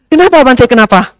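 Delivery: very high, loud demanding why; insisting lady. Retold or imitated. Pushy lady insists the monk explain, very high. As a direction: demand the reason again and again.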